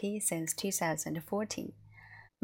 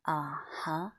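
"Uh-huh" drawn out, dipping and then rising; long, deliberate "Uh-huh"; slower uh-huh; 3 dB quieter than usual. Shown as a demonstration. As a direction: stretch "Uh-huh" slowly into a dip and lift. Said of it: "Just make it slower".

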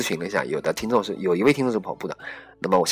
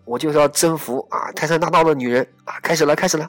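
higher, louder hammed-up girl jogger, squealing at times; listener's running monologue; 6 semitones higher, 3 dB louder than usual. Host reading a female listener's running thoughts, hammed-up higher and louder. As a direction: act out a girl cursing and chattering through her run. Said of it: "我们现在就来读一下她说的这段话".

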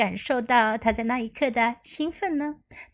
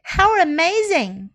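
amazed delivery; 6 semitones higher, slower than usual. Shown as a demonstration. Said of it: "你都可以说".

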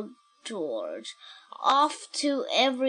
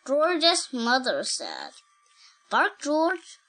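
put-on reading to a toddler; 2 semitones lower than usual. Reads picture book aloud.

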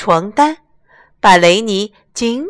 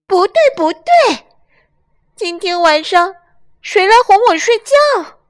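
much higher, louder lamb cry; 12 semitones higher, 4 dB louder than usual. Acted. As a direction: wail like a fed-up lamb. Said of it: "雷尼叫起来".

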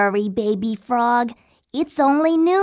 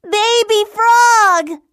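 louder, slower, high desperate call; 7 semitones higher, 4 dB louder, slower than usual. Acted. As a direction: stretch the call out in panic. Calling again, no answer.

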